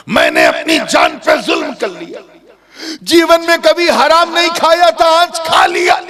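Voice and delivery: very high, loud lament; anguished confession. Acted. Youth confesses and begs, acted. (ऊँची, रोती-सी इक़बालिया पुकार)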